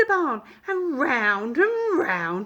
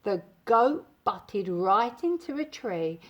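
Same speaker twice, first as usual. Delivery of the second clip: ordinary delivery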